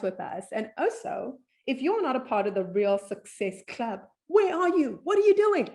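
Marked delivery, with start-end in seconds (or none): 4.3-5.7 much higher, louder playful scolding; mock outrage. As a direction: tease the listener with hammed-up disbelief. Host teases non-members.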